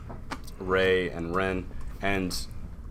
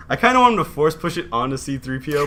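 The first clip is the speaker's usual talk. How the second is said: high, loud, quick and giggly; laughing; 7 semitones higher, 6 dB louder, faster than usual. Real, not acted. Co-host pitching a silly plot twist, laughing. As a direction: rush it out through laughter. Laughs at own story idea.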